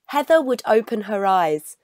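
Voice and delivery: plain reading voice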